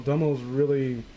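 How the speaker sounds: normal talking voice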